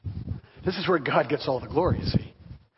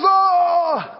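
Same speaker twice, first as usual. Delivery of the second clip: high, loud startled "Oh!"; shocked shout; 11 semitones higher, 6 dB louder than usual. Retold or imitated. Baker again reacts to the pastor, loud startled oh. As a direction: blurt it out in alarm.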